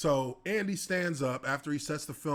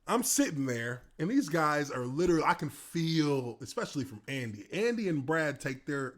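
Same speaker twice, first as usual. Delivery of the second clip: ordinary talk, nothing marked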